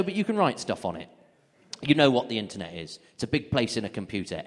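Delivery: ordinary talk, nothing marked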